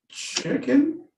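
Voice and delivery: high, rising questioning "chicken?"; high-pitched query on chicken. Acted. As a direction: lift "chicken" high like an unsure answer. Acted answer about the meal.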